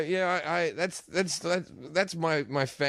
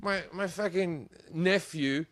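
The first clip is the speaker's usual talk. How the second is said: ordinary delivery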